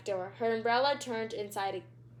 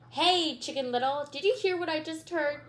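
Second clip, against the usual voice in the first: teasing big sister; 5 semitones higher than usual. Acted. Judy teasing Stink.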